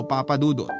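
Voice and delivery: ordinary delivery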